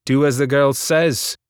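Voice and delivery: a touch of a roaring rebel. A narrator's reading. Vidius backing Hazel before the crowd, mock roar. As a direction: boom it out to the crowd. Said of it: "Vidius roared".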